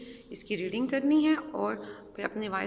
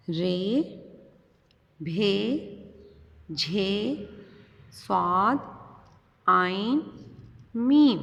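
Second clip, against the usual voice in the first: letters called out louder, building to a loud last letter; letter drill; 4 dB louder, much slower than usual. Shown as a demonstration. Teacher reciting a Urdu primer line aloud for pupils. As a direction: drill each name with long pauses. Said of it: "मेरे साथ reading करें".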